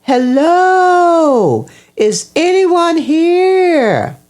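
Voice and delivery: high, drawn-out shout; calling out loudly. A narrator's reading. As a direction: call out to see if anyone is there. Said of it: "He called out to the top of his voice".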